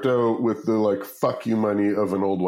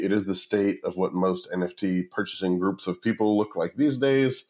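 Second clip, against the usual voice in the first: ordinary talk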